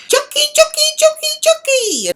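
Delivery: very high, loud chanting screech; imitated screaming. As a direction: scream it over and over like taunting boys. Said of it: "Three boys screaming out".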